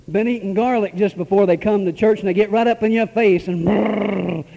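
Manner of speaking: ordinary delivery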